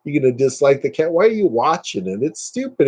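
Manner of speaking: normal talking voice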